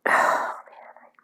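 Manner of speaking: breathy, drained whisper; whispered sigh. Real, not acted. Overwhelmed after confessing her fear, a whispered sigh. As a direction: let it out on a tired breath.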